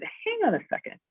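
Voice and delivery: pitched high, stunned stop; a retold double take, voice jumping up; stunned disbelief. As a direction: halt him, not believing what you hear. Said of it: "And I said".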